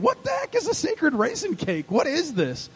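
high-pitched puzzled questioning; baffled disbelief. Acted. Preacher voicing the congregation's puzzlement at raisin cakes, baffled. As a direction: ask it as a mock-bewildered listener. Puzzled listener's thought, voiced.

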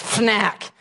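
put-on "snack" said like a toddler, sn blurred toward fn; toddler's mispronunciation. Shown as a demonstration. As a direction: mimic a three-year-old saying "snack". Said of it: "He can't pronounce snack".

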